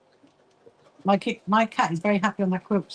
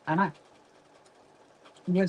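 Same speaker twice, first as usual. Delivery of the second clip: ordinary delivery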